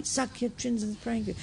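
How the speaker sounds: softly echoed reassurance; quiet, as if remembered from far away; recalled bedside words. Retold or imitated. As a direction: drop well down in volume. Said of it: "I remember you said to me".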